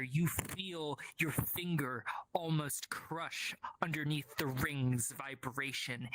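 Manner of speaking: hushed, breathy storyteller whisper; whispered narration. Acted. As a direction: whisper the vision slowly.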